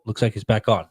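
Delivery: ordinary delivery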